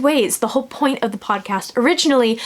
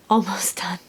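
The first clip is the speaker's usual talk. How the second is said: whispered aside, no voice; 3 semitones lower, 4 dB quieter than usual. Real, not acted. Whispering to viewers about nearly finishing the book. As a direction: breathe it like a secret.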